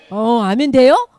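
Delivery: ordinary talk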